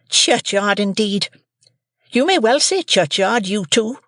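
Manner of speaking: shrill high shrewish scold; scolding sister. A narrator's reading. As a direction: Mrs Joe scolds young Pip, high and shrill.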